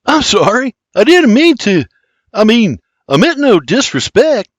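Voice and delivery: high-pitched, flustered apology; stammering apology. A narrator's reading. William backs away from Mary, stammering nervously. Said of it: "he stammers".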